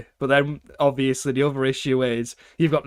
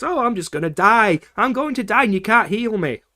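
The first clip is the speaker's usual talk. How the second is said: higher, louder mocking whine; mock whining ally; whiny companion; 7 semitones higher, 4 dB louder than usual. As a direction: whine it like a helpless sidekick.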